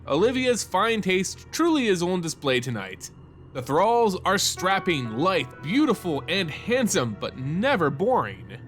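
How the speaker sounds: ordinary delivery, nothing marked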